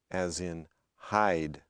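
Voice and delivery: hide gliding from ah to ee, slow; slow diphthong demo; eye diphthong. Shown as a demonstration. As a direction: stretch hide into a full glide. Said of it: "sounds of some of the diphthongs".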